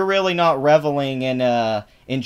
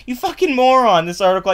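loud furious yell, voice jumping higher; angry outburst; 9 semitones higher, 6 dB louder than usual. Real, not acted. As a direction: blast him with sudden rage. Explodes at the tweet.

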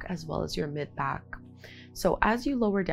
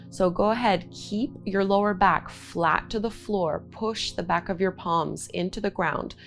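guided breathing cues. Acted. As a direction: call out each inhale and exhale.

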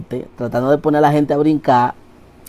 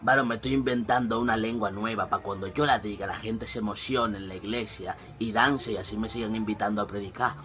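much softer, hushed scheming; sly quiet scheming; 5 semitones lower, 13 dB quieter than usual. Acted. Preacher confides his invented tongue, hushed and sly. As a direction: confide the scam under your breath. Sketch: preacher whispers secret plan.

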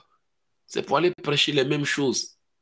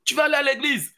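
higher firm order; command; 8 semitones higher, faster than usual. Retold or imitated. God orders the believer to church, firm higher command. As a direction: send them to church. Preacher voicing God's command.

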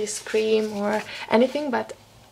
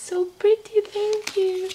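much higher and louder, gushing; delighted squeal; 10 semitones higher, 3 dB louder than usual. Real, not acted. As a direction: squeal with real joy at the gift. Delighted by a llama pen.

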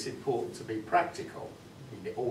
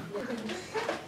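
laugh; 11 semitones higher than usual. Real, not acted. Laughing at his own joke.

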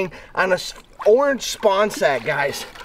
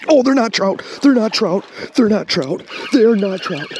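loud, startled cry of realisation; excited shout; 3 semitones higher, 4 dB louder than usual. Real, not acted. Angler yells to companions that the fish aren't trout, excited shout. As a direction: call it out in urgent excitement.

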